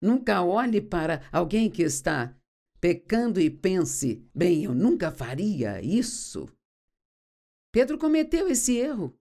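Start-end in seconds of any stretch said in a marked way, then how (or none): 4.3-6.5 lightly acted smugness, slow and lower; pensamento arrogante. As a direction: slowly voice a proud, self-assured thought. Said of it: "uma atitude arrogante e orgulhosa". (levemente arrogante, devagar e mais grave)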